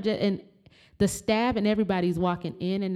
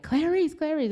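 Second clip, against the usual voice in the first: calling out; 10 semitones higher than usual. Real, not acted. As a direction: call her through the window. Calling sister through window.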